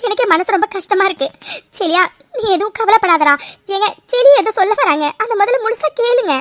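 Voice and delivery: high, loud, pleading cry to stop; distressed shouting. Acted. Mother cries out to stop the family insulting the boy, high and loud. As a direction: shout them down in desperation.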